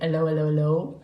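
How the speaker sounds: low, half-hearted French accent; badly faked French greeting; poor French accent. Shown as a demonstration. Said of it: "said in a French accent".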